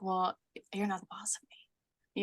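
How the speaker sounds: put-on hushed, whispered little-kid defiance; imitating defiant child. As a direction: quietly mimic a child refusing to obey. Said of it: "that's what she says, like, just like that".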